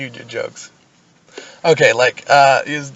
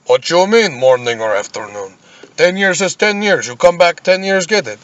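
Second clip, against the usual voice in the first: indignant Soviet clerk; 4 semitones higher than usual. Acted.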